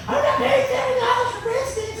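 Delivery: high, laughing impression of the mom; stingy mom. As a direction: squeak it out like a penny-pinching mom. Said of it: "the mom's like".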